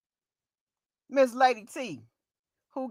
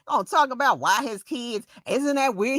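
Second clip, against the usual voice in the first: ordinary delivery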